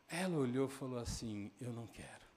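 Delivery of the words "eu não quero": soft, understated rejection; quiet refusal. Retold or imitated. Mother refusing the television from her son, quiet refusal.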